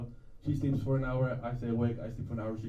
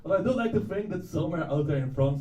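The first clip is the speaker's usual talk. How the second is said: ordinary talk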